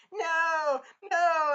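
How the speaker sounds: high, loud mock scream of terror; imitated screaming. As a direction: scream no like the condemned. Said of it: "they're, like, screaming".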